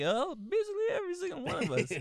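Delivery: extremely high, silly cartoon voice; goofy high turtle reply; talking turtle. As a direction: answer in a squeaky drawn-up falsetto. Playing turtle answering.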